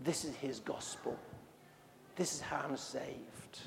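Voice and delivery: hushed declaration. Retold or imitated. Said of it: "publicly shouting".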